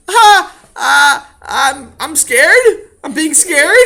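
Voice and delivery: put-on high, loud whimpering kid voice; scared child. Boy stuck on ladder.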